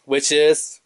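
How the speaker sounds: ordinary talk, nothing marked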